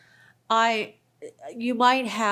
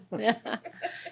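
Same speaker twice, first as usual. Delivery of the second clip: short laugh; 6 dB quieter than usual. Real, not acted. Joke about Denise at meeting.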